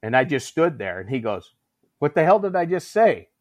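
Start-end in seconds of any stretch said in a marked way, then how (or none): 2.0-3.3 louder, higher demand; retold sergeant rebuke. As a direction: imitate an impatient drill instructor. Senior rebukes the hesitating recruit.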